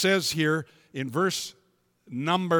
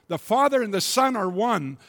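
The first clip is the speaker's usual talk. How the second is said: loud, raised preaching voice; full-force preaching; 8 semitones higher, 7 dB louder than usual. Acted. Preacher voicing Jesus to the congregation, loud and raised.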